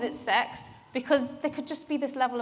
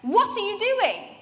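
shouting at a friend over the phone; screaming back; 7 semitones higher, 7 dB louder than usual. Retold or imitated. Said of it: "And I screamed back at him".

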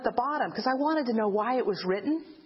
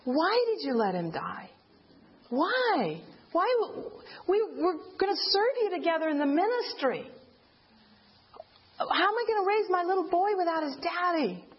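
pleading, higher and breaking, retold grief; crying out to God; 5 semitones higher than usual. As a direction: cry out to God in anguish, reliving the loss. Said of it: "I started crying out".

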